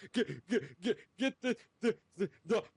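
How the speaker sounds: high, laughing imitation of a panicked plea; mock crying plea. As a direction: squeak it out through laughter, mock-terrified. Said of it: "he's, like, crying".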